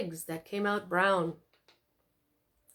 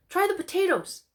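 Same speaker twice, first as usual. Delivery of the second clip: eager daughter; 11 semitones higher, 5 dB louder than usual. A narrator's reading. Daughter eagerly offers her dish.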